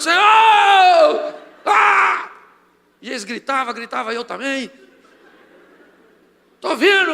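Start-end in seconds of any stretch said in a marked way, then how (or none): 0.0-2.3 high, loud cry of prayer; ecstatic prayer shout. Retold or imitated. Orando e gritando na igreja. 6.6-7.1 high loud cry of revelation; excited shout. Retold or imitated. Grita que está vendo.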